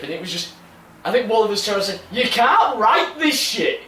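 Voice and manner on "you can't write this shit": higher, slow and laughing; laughing mock-incredulous exclamation; retold amazed exclamation. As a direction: stretch it out in amused disbelief. Retold exclamation from train trip.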